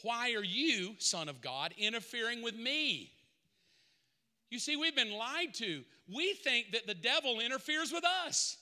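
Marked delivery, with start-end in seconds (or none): none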